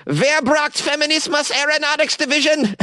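high, hammed-up proud announcement; mock grand announcer. Host mocking the feminist group's aeronautics division, hammed-up announcer. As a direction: announce the division like a pompous herald.

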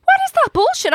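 high-pitched incredulous outburst; indignant disbelief. Real, not acted. Runner owner reacting to age shaming, high indignant outburst. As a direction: shoot the pitch right up in disbelief.

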